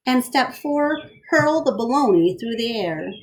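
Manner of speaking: lively storybook read-aloud; bright picture-book reading; reading to children. A narrator's reading. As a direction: read it to a class of kids.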